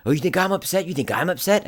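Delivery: high-pitched incredulous challenge; angry retort. Retold or imitated. Knife-wielding man answering an apology, angry retort. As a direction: hurl it back, affronted.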